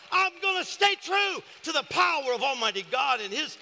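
high, loud declaration building to a shout; impassioned pulpit proclamation; full-force preaching. Real, not acted. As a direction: preach it with rising fervor to a roaring finish.

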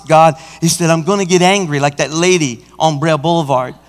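usual speaking voice

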